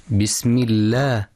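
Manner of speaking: light, clear L in "الله" after kasra; thin lam. Shown as a demonstration. Teacher showing the name of God after kasra, thin lam. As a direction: keep "بسم الله" thin on the L. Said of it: "واتفقوا كذلك على ترقيق لام".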